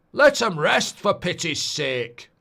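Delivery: slow, loud dramatic actor impression; Sean Bean. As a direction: draw it out as a gruff plea. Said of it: "I can do Sean Bean".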